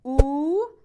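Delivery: oo held out as a closed, rounded vowel. Shown as a demonstration. Teacher showing viewers a closed vowel, oo. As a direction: say oo with a nearly closed mouth. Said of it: "The first one was closed".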